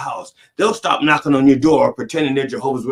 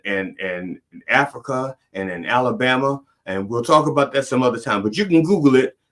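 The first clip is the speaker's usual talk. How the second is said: usual speaking voice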